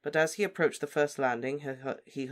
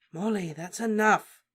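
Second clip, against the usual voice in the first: firm; 7 semitones higher, 5 dB louder than usual. Acted. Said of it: "said Lupin firmly".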